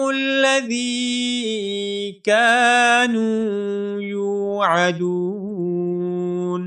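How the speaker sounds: slow, melodic tajweed chant; Quran recitation. Shown as a demonstration. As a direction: recite it drawn out like a qari. Recites verse. (تجوید کے ساتھ تلاوت)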